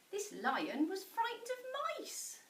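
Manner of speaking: plain reading voice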